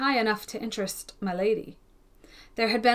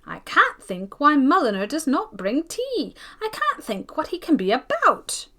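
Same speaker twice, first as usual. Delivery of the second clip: fidgety hostess; 6 semitones higher, 4 dB louder than usual. Acted. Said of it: "His mistress fidgeted and fidgeted".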